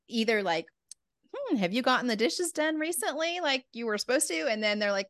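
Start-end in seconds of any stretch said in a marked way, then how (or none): 1.3-4.5 higher, sing-song and falsely sweet question; a fake-nice hint about the chores; passive aggressive. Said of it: "Mom, you're so passive aggressive".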